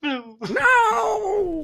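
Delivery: high, drawn-out wail of "no"; dramatic cry of no. Pretend. Game master reacting to the failed toss, dramatic long cry.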